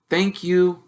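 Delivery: put-on nervous boy asking his uncle; young Harry, unsure and polite; timid boy.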